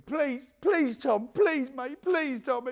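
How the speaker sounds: high, loud desperate pleading; begging. Retold or imitated. Blundell with a gun to his head begging Tommy, high desperate pleading. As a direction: plead with the gunman for your life. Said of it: "he's begging".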